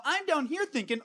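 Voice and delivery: usual speaking voice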